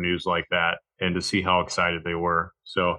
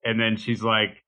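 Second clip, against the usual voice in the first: normal talking voice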